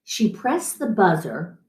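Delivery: plain reading voice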